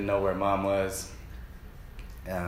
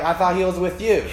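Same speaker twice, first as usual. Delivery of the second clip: raised, louder retort of a worried parent; mock parental blame; 6 semitones higher, 5 dB louder, faster than usual. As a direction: toss the blame back, lightly acted. Parents realise the child is missing.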